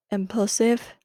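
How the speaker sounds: ordinary talk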